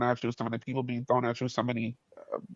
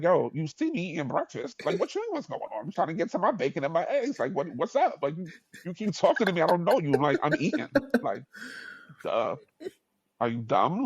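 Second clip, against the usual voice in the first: mildly annoyed retort, pitched higher; New York attitude; 5 semitones higher than usual. Retold or imitated. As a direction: act out being bothered mid-meal, with attitude. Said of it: "I always have attitudes".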